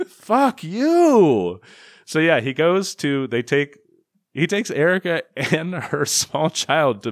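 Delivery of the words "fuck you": loud, drawn-out exasperated swearing; fed-up, long-held "you"; exasperated outburst. Real, not acted. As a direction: let it burst out, high and loud.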